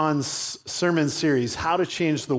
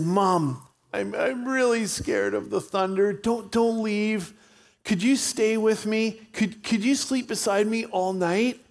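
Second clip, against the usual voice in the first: put-on high, shaky child voice; frightened four-year-old begging mom to stay; scared little boy; 7 semitones higher than usual. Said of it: "shaky little voice".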